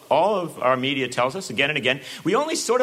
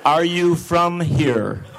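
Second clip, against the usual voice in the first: mock condescending reporter, loud and slow; hammed-up patronizing reporter addressing a laborer; much slower than usual. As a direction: talk down to a worker, loud and very slow. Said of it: "speak in a loud, slow voice".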